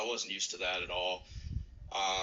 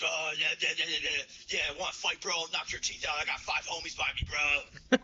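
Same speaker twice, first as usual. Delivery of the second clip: higher, louder mock tough-guy bluster; imitated aggressive overreaction; 6 semitones higher, 3 dB louder than usual. New Yorker turning a stepped-on shoe into a fight, mock aggression. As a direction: puff up into a hammed-up fight challenge. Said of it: "like, a big deal".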